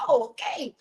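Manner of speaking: ordinary talk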